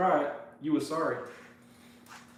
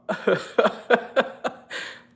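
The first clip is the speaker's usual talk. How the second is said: real high burst of laughter; long, helpless cackle; laughing; 7 semitones higher, 4 dB louder than usual. Real, not acted. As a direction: crack up at the teasing. Laughs at being teased.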